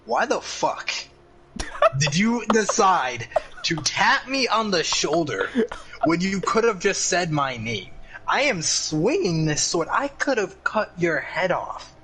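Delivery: annoyed Samuel. Acted. Samuel chews out the player for tapping him, slow indignant rant. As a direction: lecture him, slowly, for being so dumb. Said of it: "Samuel kinda like".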